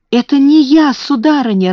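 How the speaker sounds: high, loud tearful protest; sobbing plea. A narrator's reading. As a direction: plead your innocence through tears. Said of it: "рыдая, оправдывалась Бекки". (рыдая, высоко и громко)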